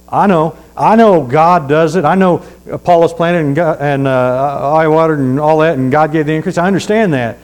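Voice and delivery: loud, fervent preacher; preaching at full force. Acted. Preacher scolding the congregation about soul winning, fervent preaching. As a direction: drive it home from the pulpit.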